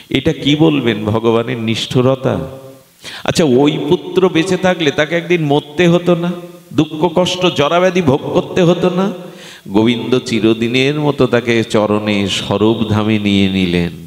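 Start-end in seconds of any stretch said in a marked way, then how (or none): 3.2-9.1 high rhetorical questioning; insistent preacher's challenge; impassioned preaching. Acted. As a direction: press the questions on the congregation, pitched high.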